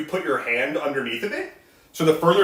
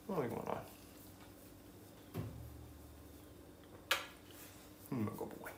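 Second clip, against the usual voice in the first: talking to a dog; 5 semitones lower, 11 dB quieter than usual. Real, not acted. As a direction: coo gently at a sweet pet. Cooing softly at her dog Flynn.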